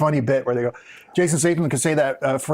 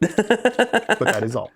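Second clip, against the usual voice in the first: slow deadpan repeat; much slower than usual. Real, not acted.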